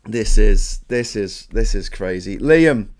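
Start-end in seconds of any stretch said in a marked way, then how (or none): none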